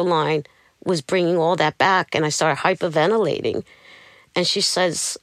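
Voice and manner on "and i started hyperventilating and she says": ordinary delivery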